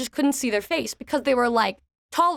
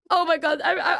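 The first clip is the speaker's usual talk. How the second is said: ordinary talk, nothing marked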